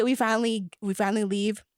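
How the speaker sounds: ordinary delivery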